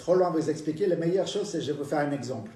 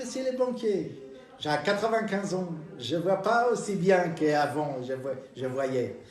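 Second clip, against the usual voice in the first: put-on old lady voice; 3 semitones higher, slower than usual. Old lady asks to touch.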